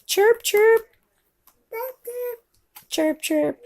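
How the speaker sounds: high-pitched double chirp; bird chirp. Pretend. Parent prompting child with bird sound, high chirps. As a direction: pipe it like a little bird.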